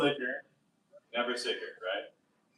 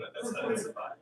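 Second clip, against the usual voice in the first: usual speaking voice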